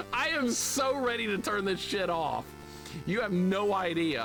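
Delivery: high, emphatic exasperation; fed up. Real, not acted. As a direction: complain like you've had enough. Fed up with the game.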